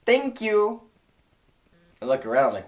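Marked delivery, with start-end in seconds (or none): 0.0-0.8 high, polite mock Chinese accent; high-pitched Chinese-accented thanks; imitated Chinese owner. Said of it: "And she says".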